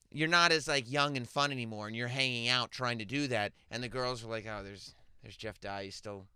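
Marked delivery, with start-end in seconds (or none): 4.5-6.3 low, slow, softened imitation of onlookers; mock disappointed aside. Host voices girls spotting the aging partier, mock disappointed aside. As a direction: quietly imitate girls noticing someone past his prime. Voicing unimpressed girls in a scenario.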